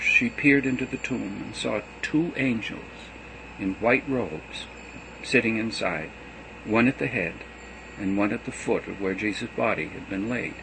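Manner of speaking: plain reading voice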